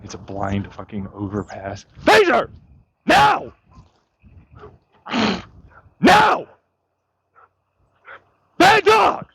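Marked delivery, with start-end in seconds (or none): 2.0-9.3 high, loud, sharp commands; yelling at the dog. Real, not acted. Owner ordering his runaway dog back, loud angry shouts. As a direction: bark out each command at the dog.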